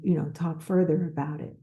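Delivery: usual speaking voice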